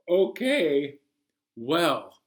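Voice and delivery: plain reading voice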